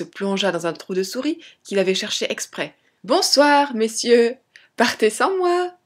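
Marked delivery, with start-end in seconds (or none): 3.0-5.7 put-on high, louder child's taunt; gleeful mocking call. A narrator's reading. Tom escapes the two men, high gleeful farewell call. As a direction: call out cheekily in a high boy's voice. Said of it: "leur cria-t-il en riant".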